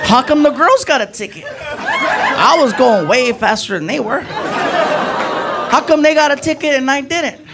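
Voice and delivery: high, drawn-out whine; mock complaint. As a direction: whine it high like a wronged kid. Acted complaint to the cop.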